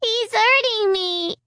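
put-on high, slow little-girl wail; a toddler crying that it hurts; wailing child. A narrator's reading. Little Alicia wailing to the grown-ups. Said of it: "Alicia wailed".

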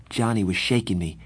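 plain reading voice

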